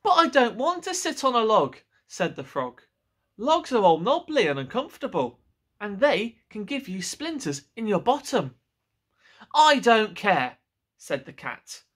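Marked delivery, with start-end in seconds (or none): 0.0-8.6 high, pleading frog voice; whiny frog. Acted. Frog complaining to the cat. 9.5-10.6 slow, loud flat refusal; stubborn cat. Acted. Cat dismissing the frog.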